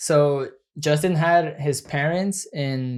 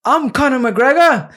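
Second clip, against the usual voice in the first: much higher, louder Irish brogue; Irish accent; 10 semitones higher, 8 dB louder than usual. Shown as a demonstration. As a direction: crank up the cocky Irish swagger. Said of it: "Can you do a Irish accent?".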